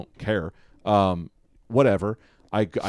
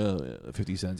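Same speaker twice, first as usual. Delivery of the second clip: arrogant mock; 5 semitones lower, 9 dB quieter than usual. Comic talking about a stingy open micer, imitating him low and smug. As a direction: mimic a snooty cheap customer, low and soft. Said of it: "he was very arrogant about".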